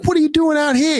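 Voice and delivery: eager broad Boston; Boston accent. Retold or imitated. Asking the driver, excited Boston accent. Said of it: "having a Boston moment".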